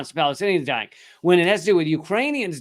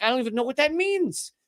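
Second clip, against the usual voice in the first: mocking clueless official; 10 semitones higher than usual. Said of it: "he's like".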